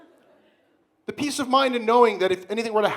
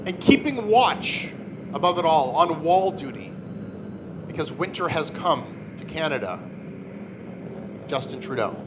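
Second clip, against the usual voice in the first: dramatic narration; 3 semitones lower than usual. Acted. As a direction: narrate it like a grim fantasy epic.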